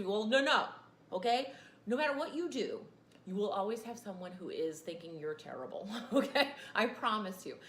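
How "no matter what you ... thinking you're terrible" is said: ordinary delivery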